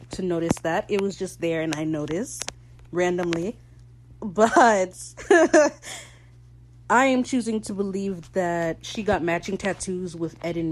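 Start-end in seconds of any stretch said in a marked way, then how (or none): 5.0-6.0 high, loud burst of giggling; giggle. Real, not acted. Speaker amused by their own tattoo remark, giggling.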